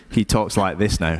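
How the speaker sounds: a hint of dad's present-day voice; imitating his dad. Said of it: "doesn't even have an Indian accent anymore".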